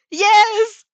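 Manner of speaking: very high, loud gleeful cry; exultant high squeal of joy; laughing delight. A narrator's reading. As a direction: shout it with childish glee. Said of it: "she laughs".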